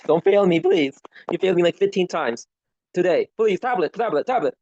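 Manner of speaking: real exasperation at the tablet; frustrated pleading. Real, not acted. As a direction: beg the thing not to fail you again.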